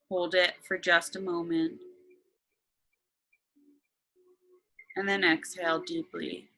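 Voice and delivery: slow, calm meditation voice; guided meditation. Acted.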